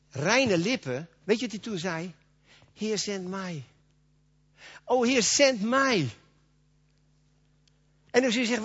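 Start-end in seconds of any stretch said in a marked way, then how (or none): 2.7-3.6 soft plea. Acted. Isaiah's reply, first softly. 4.8-6.1 fervent plea. Acted. Isaiah's reply, repeated fervently.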